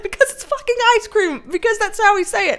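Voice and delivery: high, heated mock-argument voice; exasperated retort. Husband answering wife's ice cream question, exasperated. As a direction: snap back insistently, pitched high.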